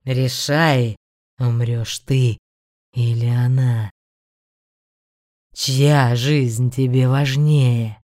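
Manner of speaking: low, loud, slow demonic demand; menacing demon voice. Acted. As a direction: cruel beast demanding a choice. Demon forces a choice. (грозный голос демона)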